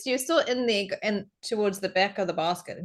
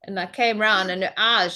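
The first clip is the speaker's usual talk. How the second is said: normal talking voice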